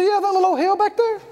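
casually unimpressed; dismissive. Retold or imitated. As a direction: wave it away lightly. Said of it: "He said".

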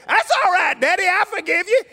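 put-on high, loud, eager little-boy voice; imitating a young son forgiving Daddy; five-year-old boy. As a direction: pipe up like a delighted small child. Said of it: "He wasn't but five".

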